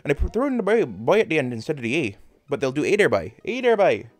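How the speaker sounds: quick, lilting Newfoundlander accent. Shown as a demonstration. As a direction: imitate a Newfoundlander, "boy" tacked on. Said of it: "they start sounding like this there".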